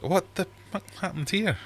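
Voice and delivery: stunned at the mess in the tank; shocked. Retold or imitated. Finding the dead fish.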